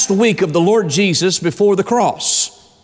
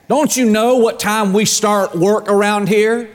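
ordinary talk

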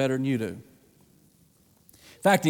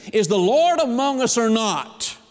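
high, drawn-out mock complaint; complaining cry; 7 semitones higher, 4 dB louder, slower than usual. Preacher quotes thirsty Israel doubting God, in a complaining cry. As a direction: cry it out as a challenge. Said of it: "began to complain and cry and say".

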